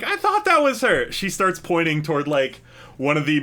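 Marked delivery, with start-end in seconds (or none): 0.0-1.1 sudden squeaky high voice of a tipsy woman; high drunk woman. Acted. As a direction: shoot way up high, wide-eyed and tipsy.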